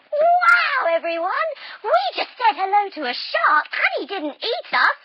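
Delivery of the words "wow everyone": high, loud cheering wow; loud delighted whoop for the kids; excited wow. Acted. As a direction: cheer like you just survived. Celebrating after the shark passes.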